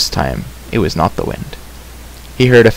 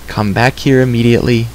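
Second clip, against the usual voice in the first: usual speaking voice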